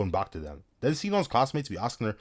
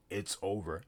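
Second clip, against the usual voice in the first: hushed, much softer quote; quoted soft resignation; 12 dB quieter, slower than usual. Acted. Sinon and Kirito talking as killer lurks, hushed mock-solemn. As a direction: drop the volume right down. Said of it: "you're talking about some".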